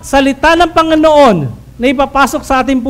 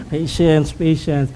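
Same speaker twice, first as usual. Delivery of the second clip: soft self-soothing; 8 semitones lower, 6 dB quieter, slower than usual. Pretend. Calming self while angry.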